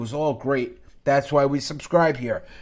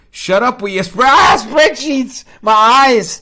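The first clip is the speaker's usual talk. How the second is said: loud, higher, hammed-up yell at the spreadsheets; mock outraged shout; 7 semitones higher, 10 dB louder than usual. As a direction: fake horror, shouting as if the sight hurts your eyes. Mock rant at rival's spreadsheets.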